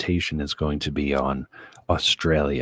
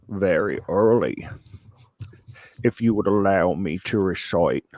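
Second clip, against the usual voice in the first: broad mock Aussie presenter; exaggerated Australian accent. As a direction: announce it in a put-on Australian accent. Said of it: "in an exaggerated Australian accent".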